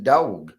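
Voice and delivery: "dog" said as the speaker's own word; dog without a W. Shown as a demonstration. Host answering critics of how they say "dog", own vowel. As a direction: say "dog", insisting there is no W. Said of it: "I'm not pronouncing it with a W".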